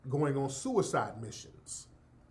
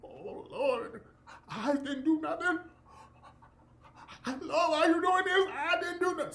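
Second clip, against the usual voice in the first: high, whiny, pleading mock voice; mock a scared man begging for mercy; mock groveling; 13 semitones higher than usual.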